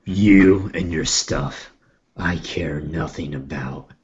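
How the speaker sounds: slow, dismissive in-character line; threatening character voice. Acted. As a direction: speak low and slowly, with open disdain. Player voices Nog.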